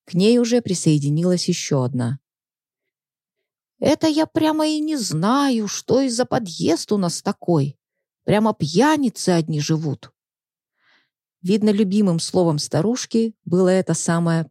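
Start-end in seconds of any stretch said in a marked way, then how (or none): 3.8-10.1 put-on grumpy old woman. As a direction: grumble like a nosy old lady. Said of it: "ворчливой старушенцией".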